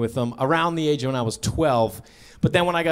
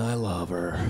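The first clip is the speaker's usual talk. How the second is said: much lower, soft sincere; mean it softly; 12 semitones lower, 9 dB quieter, slower than usual. Real, not acted. Sincere after joke.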